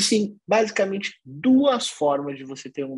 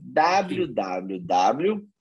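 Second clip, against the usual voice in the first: letters dragged out very slowly; very slow spelled-out letters; slow dictation; much slower than usual. Shown as a demonstration. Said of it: "o site é".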